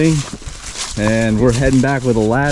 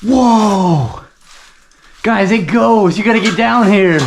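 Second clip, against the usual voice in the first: excited; 7 semitones higher, slower than usual. Real, not acted. Discovers cave goes on.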